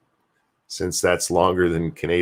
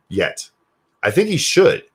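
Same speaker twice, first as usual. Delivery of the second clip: usual speaking voice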